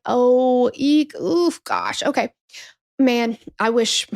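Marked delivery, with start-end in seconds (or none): 0.1-2.3 squirming, reluctant little noises; awkward cringe. Retold or imitated. Shop clerk cringing at the customer, awkwardly reluctant. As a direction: wince your way through a no. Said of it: "And she's like".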